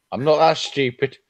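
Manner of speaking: ordinary talk, nothing marked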